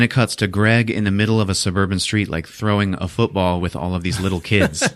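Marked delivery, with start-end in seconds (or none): none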